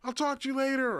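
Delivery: high, bright-pitched imitation of a casual passerby; tossed-off friendly greeting, voiced way up; breezy celebrity hello; mock music legend. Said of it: "they're like".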